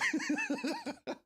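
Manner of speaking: squealing giggle; a piercing little laugh; high laugh. Real, not acted. Remembering funny clip.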